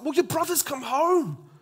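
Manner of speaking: a servant breathlessly explaining, lightly played; servant's voice. Servant telling the elder brother the news, lightly voiced. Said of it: "And the servant's like".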